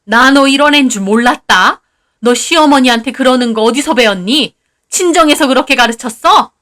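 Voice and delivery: loud, shrill-free angry outburst; excited mother-in-law. Acted. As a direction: berate the daughter-in-law loudly. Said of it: "어머님이 흥분해서 뭐라고 하시더군요". (흥분한 시어머니의 큰소리)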